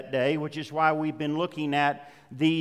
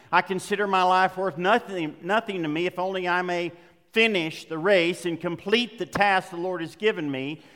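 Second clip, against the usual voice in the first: normal talking voice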